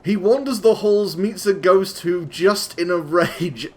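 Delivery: hammed-up epic reading; dramatic storyteller voice. Shown as a demonstration. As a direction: boom it out like a bard opening a saga. Dramatic redo of the verse.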